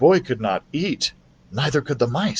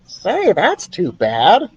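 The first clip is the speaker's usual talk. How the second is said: higher mouse drawling slowly; slow, sympathetic mouse voice; 7 semitones higher, much slower than usual. Acted. Ralph the mouse consoles Keith, slow and high. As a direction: stretch it out kindly, small mouse.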